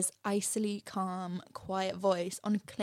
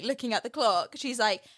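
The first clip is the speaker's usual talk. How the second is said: ordinary talk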